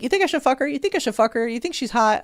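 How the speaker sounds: high-pitched, repeated questioning; imitated eager asking. Friend kept asking for an opinion, imitated eager asking.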